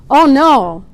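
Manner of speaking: loud faux-surprised gasp of oh no; French man feigning surprise, mock gasp. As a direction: gasp it out in fake shock, loud. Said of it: "he gasped with faux surprise".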